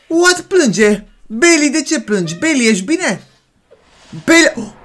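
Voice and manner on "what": startled what. Real, not acted. Hears a dog-like sound.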